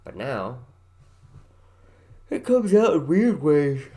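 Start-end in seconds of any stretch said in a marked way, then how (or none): 2.3-3.9 loud high. Pretend. Sudden loud high burst.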